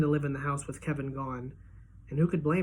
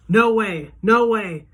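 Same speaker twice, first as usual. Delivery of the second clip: loud, higher cry of disbelief; shouting denial; 5 semitones higher, 10 dB louder, much slower than usual. A narrator's reading. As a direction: yell it out, refusing the news. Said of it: "I'm saying".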